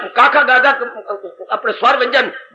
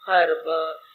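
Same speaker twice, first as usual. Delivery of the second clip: mock lament; 8 semitones lower, 7 dB quieter, slower than usual. Distracted woman's lament.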